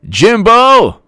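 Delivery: high, loud hail of a name; excited call-out. Real, not acted. Calling out a viewer's name.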